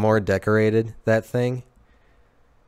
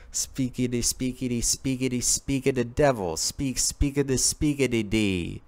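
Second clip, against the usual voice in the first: higher, soft scat-like patter; Speakeasy Tom; 4 semitones higher, 5 dB quieter than usual. Pretend. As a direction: chatter nonsense syllables in a small put-on voice. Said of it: "Speakeasy Tom".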